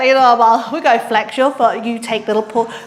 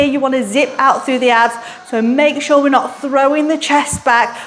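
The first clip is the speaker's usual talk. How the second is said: ordinary delivery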